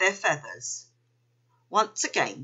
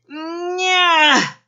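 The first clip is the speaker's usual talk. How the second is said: high, loud, drawn-out straining whine; long squeal of effort, swelling loud; straining effort noise; 11 semitones higher, 8 dB louder than usual. A narrator's reading. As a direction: strain upward until it bursts out. Keith strains to hop.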